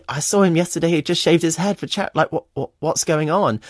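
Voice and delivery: retold bewilderment.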